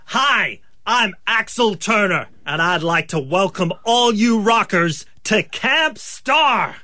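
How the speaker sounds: put-on high, slow projected American rock-star accent. Actor tries his first line, American accent. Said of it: "put on a credible American accent".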